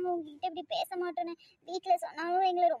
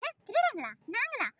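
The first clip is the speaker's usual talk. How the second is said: high, incredulous questions; indignant disbelief; 4 semitones higher than usual. Acted. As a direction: throw the accusation back, offended. Girl reacts to being called a thief. (நம்பமுடியாத கோபத்தில் கேள்வி)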